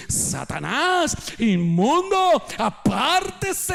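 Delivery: slow, high, drawn-out rebuke; mock rebuke. Peter imitating Jesus's rebuke, slow and high. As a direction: stretch each word out high and slow.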